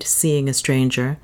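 ordinary delivery

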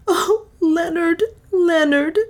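high, slow, tearful wail; weeping bitterly. A narrator's reading. Juana cries to Leonard over her lost servants, weeping bitterly. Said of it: "weeping bitterly".

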